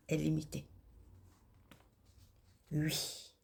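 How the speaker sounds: ordinary delivery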